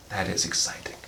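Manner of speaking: breathy dry whisper. Shown as a demonstration. Unboxing films, whispers his excitement to viewers. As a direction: whisper it like a secret. Whispered repeat of same line.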